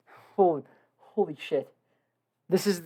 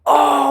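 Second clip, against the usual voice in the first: gasp; 8 semitones higher, 9 dB louder than usual. Real, not acted. Sudden realization gasp.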